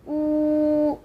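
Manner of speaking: "oo" with lips pushed forward and rounded; a narrow, rounded "oo". Shown as a demonstration. Teacher showing learners how to round for the vowel. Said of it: "to make this sound".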